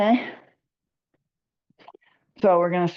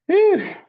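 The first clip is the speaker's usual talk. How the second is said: laughing exhale after effort; breathy whew; 12 semitones higher, 3 dB louder than usual. Real, not acted. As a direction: blow out a relieved whew. Winded after the exercise set.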